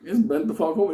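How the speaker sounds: ordinary delivery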